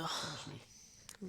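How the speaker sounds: breathy teasing whisper. Pretend. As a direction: whisper it playfully. Teasing banter with partner.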